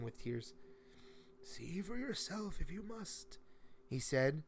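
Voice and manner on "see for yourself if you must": put-on higher, slow old man, a bit softer; a lightly voiced old man, resigned; old man's voice. Old man gesturing the boy to the door, resigned.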